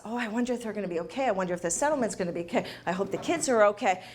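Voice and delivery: fast, fretting murmur of worry; quick worried inner voice. Retold or imitated. Speaker voicing a friend's divorce worries, fast and fretful. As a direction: rattle off the worries like an anxious friend.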